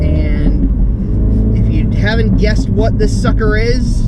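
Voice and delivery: big booming welcome to viewers; loud presenter intro. Acted. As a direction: announce it loud like a show host. Loud car video intro.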